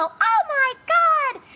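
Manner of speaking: high-pitched panicked public outcry, hammed up; mock outrage. People outraged at gas prices, shrill mock panic. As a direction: wail it like an appalled crowd.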